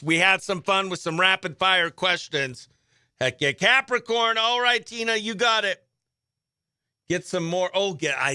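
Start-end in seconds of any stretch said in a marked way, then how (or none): none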